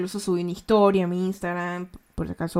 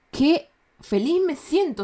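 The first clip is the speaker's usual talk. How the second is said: slow, higher-pitched happy exclamation; feliz; 7 semitones higher than usual. Retold or imitated. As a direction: draw it out in blissful delight. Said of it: "sino que terminé como". (felicidad exagerada, alargando las palabras)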